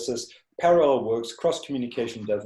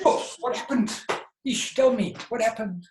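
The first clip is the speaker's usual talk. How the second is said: much higher, gentle coaxing question; nurturing voice to a child; 12 semitones higher, 4 dB louder than usual. Shown as a demonstration. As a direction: coax her like an upset child. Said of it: "I go to".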